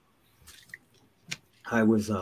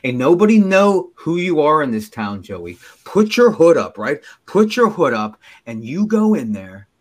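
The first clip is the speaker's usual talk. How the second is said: loud, high, insistent commands; the addiction talking, loud and bossy; disease's urging; 5 semitones higher, 10 dB louder than usual. Retold or imitated. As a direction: push someone into it, forcefully. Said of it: "the disease tells you".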